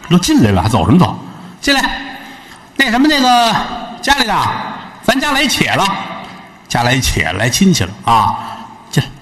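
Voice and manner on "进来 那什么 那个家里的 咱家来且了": put-on high, coy young wife calling her husband. Wife calling her husband about the guest, high and coy. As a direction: sing out to him like a sly young wife.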